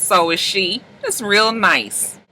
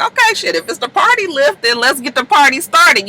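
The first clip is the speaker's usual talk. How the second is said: mock hype guy; 9 semitones higher than usual. Passenger voiced in recap.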